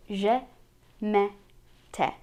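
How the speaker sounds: je, me, te with open "eh"; accent grave vowel. Shown as a demonstration. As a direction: say je, me, te one by one, vowel as in "bet". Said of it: "if we add the accent grave".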